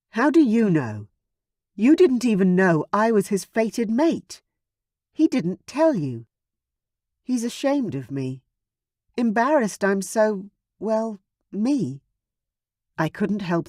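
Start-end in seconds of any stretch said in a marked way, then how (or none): 0.1-12.0 upset protest. A narrator's reading. Daughter protesting to Uncle Stavrock, hurt and upset. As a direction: push back at him, stung.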